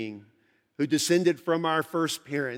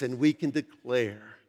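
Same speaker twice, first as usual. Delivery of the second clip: normal talking voice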